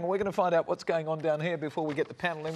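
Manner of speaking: ordinary delivery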